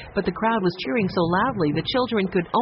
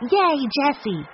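higher, louder cheer; cheering shout; 9 semitones higher, 3 dB louder, slower than usual. A narrator's reading. The children cheer loudly for their sister Jessie. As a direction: cheer for her across the field. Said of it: "and shouted".

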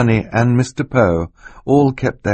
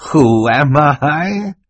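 high, drawn-out incredulous question; hammed-up innocence, loud and slow; mock-innocent villain; 9 semitones higher, 5 dB louder, much slower than usual. A narrator's reading. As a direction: stretch it out slowly in feigned astonishment. Stefano feigns innocence when accused.

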